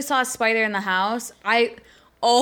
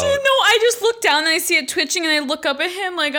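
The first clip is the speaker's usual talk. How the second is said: ordinary delivery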